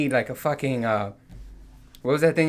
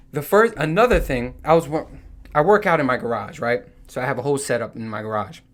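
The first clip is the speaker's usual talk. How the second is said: ordinary talk, nothing marked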